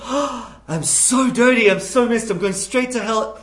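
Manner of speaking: hammed-up lament of a guilty heart; mock anguish. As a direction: wail it like a sinner at rock bottom. Acted despairing sinner's cry.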